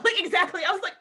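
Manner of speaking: normal talking voice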